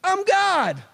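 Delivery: high mock-grand declaration of being God; brother claiming godhood. Brother coming home to tell family, mock-grand high announcement. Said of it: "your brother came home and he went".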